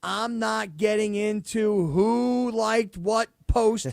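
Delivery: slow, drawn-out brush-off as J T; J T impression. Radio host mimicking J T avoiding the question, slow evasive impression. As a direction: stretch each word out, dodging the subject. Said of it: "what did J T do right away".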